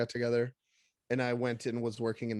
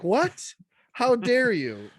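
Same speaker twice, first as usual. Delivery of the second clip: high, loud, slow indignation; hammed-up how-dare-you; mock outrage; 11 semitones higher, 7 dB louder, much slower than usual.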